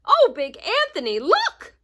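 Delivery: high, loud urgent call; nun crying out to Big Anthony; alarmed cry. A narrator's reading. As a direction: warn him, pointing in alarm. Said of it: "a sister from the convent said".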